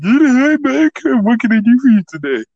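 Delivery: loud, high-pitched mock parroting; mocking imitation. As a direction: throw it back in a jeering high voice. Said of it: "He just mocks me".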